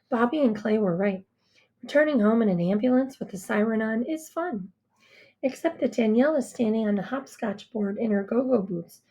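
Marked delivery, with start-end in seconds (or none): none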